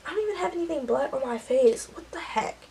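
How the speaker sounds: real puzzled self-talk, fading away; baffled aside. Real, not acted. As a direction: drop to a near-whisper by the end, baffled. Baffled aside about face smudge.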